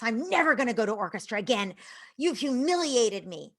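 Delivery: put-on loud, indignant kid retort; imitated outburst. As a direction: play an upset child lashing out. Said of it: "is going crazy screaming".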